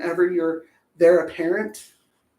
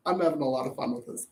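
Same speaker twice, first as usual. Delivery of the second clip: quick aside; 6 semitones lower, 7 dB quieter, faster than usual. Real, not acted. Fast quiet aside.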